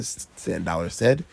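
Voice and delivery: usual speaking voice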